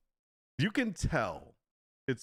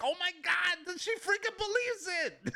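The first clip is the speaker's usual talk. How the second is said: very high-pitched mock squeal of disbelief; hammed-up astonished glee; pretend amazed scammer. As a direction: squeal like a scammer who can't believe it worked. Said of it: "then they send it out like".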